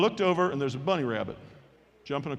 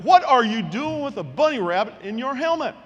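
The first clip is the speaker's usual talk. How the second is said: high, loud disbelief; mock-outraged coach voice; incredulous coach; 6 semitones higher, 5 dB louder than usual. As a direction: demand an explanation, baffled and exasperated. Coach reacts to the bunny rabbit.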